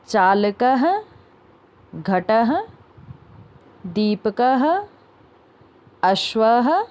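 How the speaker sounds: each word from शिक्षकः to गायकः alone, final visarga sounded "ha"; careful word-list reading, every ः ending in an audible "ha"; model pronunciation. Shown as a demonstration. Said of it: "तो इसको कैसे पढ़ेंगे".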